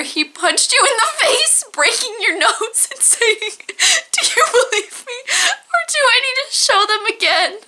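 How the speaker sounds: high-pitched, helpless laughter through the words; laughing while reading. Real, not acted. Reader losing it over the punch in the story, laughing. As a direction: read on while cracking up.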